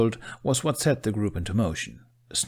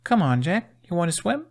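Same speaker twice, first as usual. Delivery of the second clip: coaxing; 10 semitones higher, 4 dB louder than usual. Acted. Astrid coaxing Jack.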